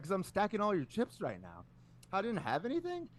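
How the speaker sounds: high, sarcastic needling; taunting comeback. Retold or imitated. Retold taunt back at sore loser.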